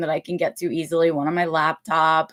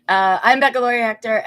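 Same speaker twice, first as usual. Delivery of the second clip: louder, higher, brighter retake of the intro; fresh restart; 4 semitones higher, 6 dB louder than usual. Real, not acted. Host restarting her intro after an interruption, louder. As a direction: start over with more energy, louder. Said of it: "and my pillow fell over".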